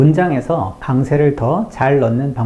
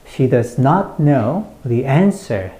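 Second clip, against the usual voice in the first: slow beats on "not", "know", "answer"; sentence stress demo; much slower than usual. Shown as a demonstration. Teacher repeats for learners, slow stress on "not know", "answer". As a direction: keep "She does" light, lean on "not know" and "answer". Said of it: "이렇게 강세를 넣으시면".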